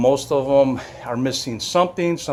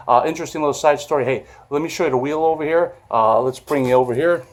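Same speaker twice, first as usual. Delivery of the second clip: normal talking voice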